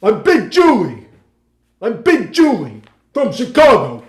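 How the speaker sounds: slow, high, booming Big Julie; loud stage projection. Retold or imitated. Friend trying again for the big theater, loud projection. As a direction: fill the whole theater with it. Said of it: "and you were loud".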